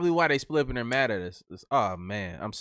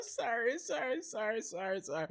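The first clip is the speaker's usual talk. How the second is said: sheepish; 8 semitones higher, 13 dB quieter than usual. Real, not acted. Apologizing through laughter, very quiet.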